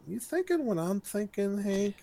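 soft, high-pitched mock Diana; hushed aside. Diana's aside to Hank, acted.

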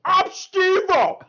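high, loud hammed-up Tracy Morgan yell; Tracy Morgan impression. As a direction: bellow it as a wild Tracy Morgan. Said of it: "Tracy Morgan's gonna be playing".

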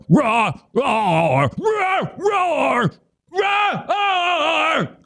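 yelling roar. Retold or imitated. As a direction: yell roar like two grown men on a stage. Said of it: "They were yelling roar".

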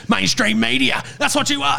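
very high-pitched jeering imitation; hammed-up accusation, voice shooting up. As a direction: hurl it as a ranting sceptic's taunt. Imitating conspiracy-minded friends' accusation.

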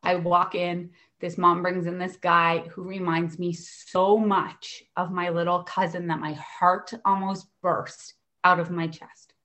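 usual speaking voice

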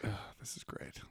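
whispered dry sarcasm; sarcastic whisper. Real, not acted. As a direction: breathe it out, dripping irony. Whispered aside after the reading.

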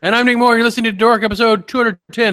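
loud, high, energetic show opener; podcast host intro. Acted. Host opening episode for listeners, loud announcer style.

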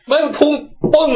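excited. Real, not acted. Excited retelling of spear.

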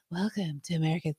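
breathy seductive whisper; come hither voice. Shown as a demonstration. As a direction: whisper it like a sexy hotline. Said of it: "in a come hither female voice".

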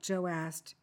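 ordinary delivery

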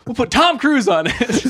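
radio ad announcer. Pretend. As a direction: hype it through laughter. Joining the mock radio ad.

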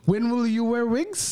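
high, slow mock German accent; drawn-out German interviewer voice. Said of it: "doing a German accent".

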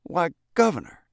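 put-on high, pitiful boy's protest; pathetic sniffling. A narrator's reading. Boy defends himself to the governor, pathetic. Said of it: "Freckles sniffled pathetically".

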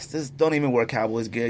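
ordinary delivery

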